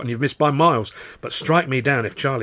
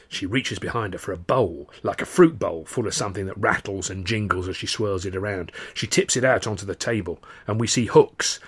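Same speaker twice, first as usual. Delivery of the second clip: usual speaking voice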